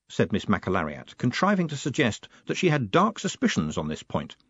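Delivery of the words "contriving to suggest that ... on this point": usual speaking voice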